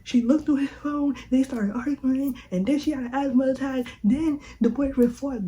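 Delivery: high, loud rapid-fire retelling; rampage. Retold or imitated. Telling Mom the whole story, a high loud rampage. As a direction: rant it all out breathlessly. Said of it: "I'm going on a rampage".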